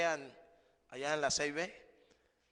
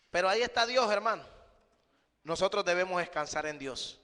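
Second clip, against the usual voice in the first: fervent preaching; 3 semitones higher, 5 dB louder than usual. Acted. Preaching at full force.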